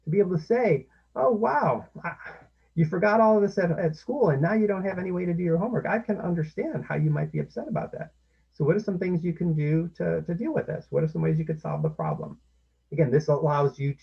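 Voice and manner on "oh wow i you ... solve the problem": higher, louder sympathetic voice; sympathetic parent. Shown as a demonstration. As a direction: show the child you understand. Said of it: "to sympathize with your child".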